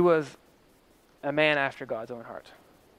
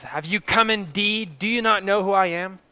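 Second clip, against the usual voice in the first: a raised, haughty challenge; bishop's indignant cry; 5 semitones higher, 5 dB louder than usual. Acted. Head bishop confronting Doctor Taylor before the council, haughty raised cry. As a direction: look down on the prisoner and demand he know your rank. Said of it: "the bishop cries".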